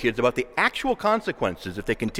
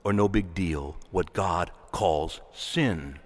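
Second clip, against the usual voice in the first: earnest pleading; 8 semitones lower than usual. Real, not acted. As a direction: beg the congregation gently. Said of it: "I beg you".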